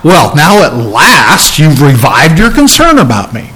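a snide, grudging "Well, now at last"; resentful, sarcastic tone. Shown as a demonstration. Pastor mocking the wrong attitude of Paul's thanks, sarcastic. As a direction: lean on "about me" like a complaint. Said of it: "that wasn't the attitude".

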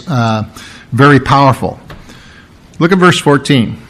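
ordinary delivery

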